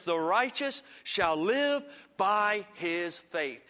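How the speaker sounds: slow, weighty voice of the Lord; the key verse, read slow and grave; slow solemn quote. Shown as a demonstration. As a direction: lean on each word, slow and solemn. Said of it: "the Lord says".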